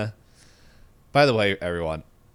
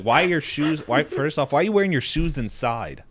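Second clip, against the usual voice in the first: higher, louder exasperated scolding; annoyed; 8 semitones higher, 5 dB louder than usual. Retold or imitated. Podcaster mocking the fiance on the couch, exasperated and high. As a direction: scold him for wearing shoes indoors, exasperated. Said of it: "That annoyed me more than".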